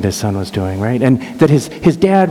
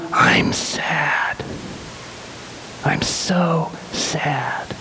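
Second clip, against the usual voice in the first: higher, whispered imitation of grief; tearful sadness; 4 semitones higher, 7 dB quieter, much slower than usual. Angry boys break down over their dads, whispered sorrow. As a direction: let it sink to a slow, voiceless whisper, as if crying. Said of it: "they start crying".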